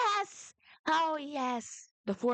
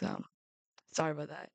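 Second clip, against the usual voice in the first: ordinary talk, nothing marked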